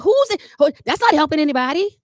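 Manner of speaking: high, loud, annoyed outburst; frustrated mama. Retold or imitated. Mama fed up with son's reminders, loud and irritated. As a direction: snap back, fed up with the pointing. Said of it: "she gets frustrated".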